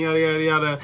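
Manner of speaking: ordinary delivery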